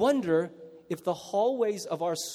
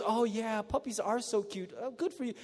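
mock sweet parent; 3 semitones higher, 6 dB quieter than usual. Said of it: "No parent ever goes".

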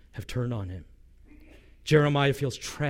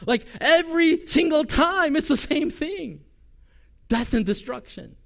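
high, slowed-down lament; exasperated complaint; 12 semitones higher, 4 dB louder than usual. Retold or imitated. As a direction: drag it out like a worn-out prophet pleading with God. Jeremiah complaining to God.

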